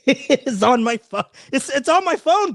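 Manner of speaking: laughing, rising excitement; can't believe it's on his phone; amused disbelief. Real, not acted. Showing the stream his photo, laughing in disbelief.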